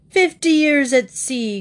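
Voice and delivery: a hint of a growling old sailor. A narrator's reading. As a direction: growl it like a gruff captain. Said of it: "growled the Admiral".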